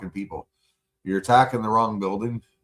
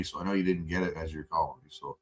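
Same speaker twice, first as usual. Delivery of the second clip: normal talking voice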